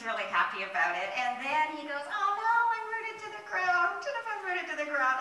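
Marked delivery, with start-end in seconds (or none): 2.1-5.2 high, loud panicky wail; hammed-up cry of dismay; mock crying. Daisy finds itself stuck in soil, mock crying. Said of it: "he starts to cry again".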